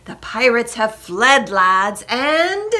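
loud announcement, rising into suspense; joyful holler. A narrator's reading. Jack tells lads the pirates fled, joyful build-up. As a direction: build up to the big find. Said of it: "Jack hollered with joy".

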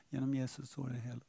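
very soft, low, hurried mumble; mumbling into beard. Acted. Believer timidly quoting Scripture to a listener, mumbled into the beard. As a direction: mumble it under your breath. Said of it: "mumlar i skägget".

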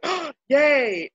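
high, delighted little cheer; excited. Retold or imitated. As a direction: squeal with new delight. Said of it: "we find it and we're like".

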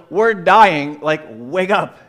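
loud retold cry of alarm; mock yell. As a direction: yell to wake him, half joking. Disciples yell at sleeping Jesus.

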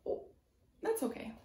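normal talking voice

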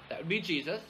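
ordinary delivery

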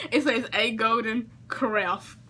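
ordinary talk, nothing marked

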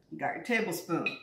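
ordinary talk

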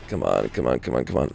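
low, soft repeated plea to hurry; urging under breath. Real, not acted. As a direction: urge it on quietly, very low. Urging the reload under his breath.

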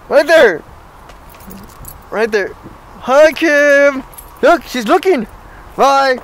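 loud, high call across lot. Real, not acted. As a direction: call out to someone far away. Calling out to sister Kimberly.

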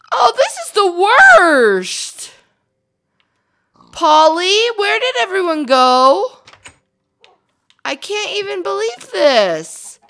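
high, loud distressed lament; distraught over her vanished cows and sheep; upset wailing. Real, not acted. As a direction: wail over the lost animals. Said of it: "I'm very upset right now".

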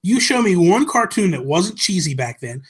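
ordinary talk, nothing marked

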